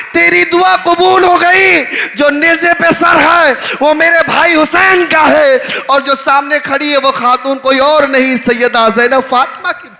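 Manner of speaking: loud, high impassioned sermon voice; loud majlis preaching of Zainab's words; preaching at full force. Acted. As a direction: call out Zainab's words to the crowd. Climax of the tragedy, shouted.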